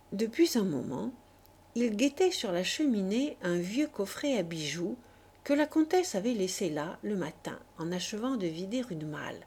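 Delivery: ordinary delivery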